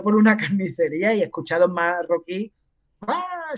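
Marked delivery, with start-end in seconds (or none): 3.0-3.5 high-pitched imitated yell, held back in volume; mock squeal. (chillido agudo imitado, contenido)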